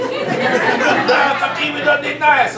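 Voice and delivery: loud, high mock defiance; French part of me. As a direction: shout down the nagging voice. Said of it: "the French part of me".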